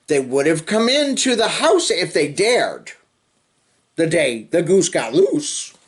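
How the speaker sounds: lively sing-song storybook reading; reading to children. Acted. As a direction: read the rhyme to little kids with bounce. Reading picture book to children.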